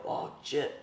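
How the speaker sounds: breathy, voiceless curse under the breath; whispered oh shit. Retold or imitated. Told his ex's baby is coming, he mutters a whispered curse. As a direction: let it slip out as a shocked whisper.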